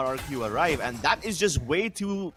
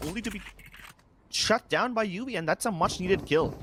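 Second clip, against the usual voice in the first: ordinary talk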